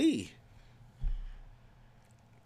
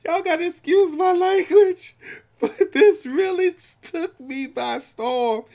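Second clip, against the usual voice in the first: high, loud words through laughter; laughing while talking; 7 dB louder than usual. Real, not acted. Laughing as she asks viewers to excuse her. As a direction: apologize while still laughing.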